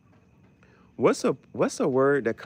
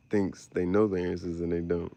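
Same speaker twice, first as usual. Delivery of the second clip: ordinary talk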